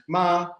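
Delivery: "ma" held high and level. Shown as a demonstration. Lecturer showing Mandarin tones to students, "ma" in high tone. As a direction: sustain "ma" on one high note. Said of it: "a high tone".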